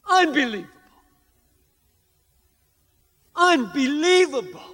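high, loud amazement at each word; astonished marvel. Real, not acted. Preacher marvelling at Paul's answer to his thorn, high astonished. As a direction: marvel in disbelief at the text.

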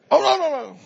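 high, quick string of denials; eager assurance. Acted. Students reassuring the professor, eagerly.

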